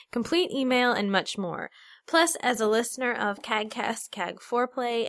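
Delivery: ordinary talk, nothing marked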